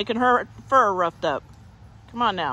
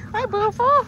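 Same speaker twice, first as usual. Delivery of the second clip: very high puppy-talk hello; cooing greeting; 12 semitones higher, 3 dB louder than usual. Real, not acted.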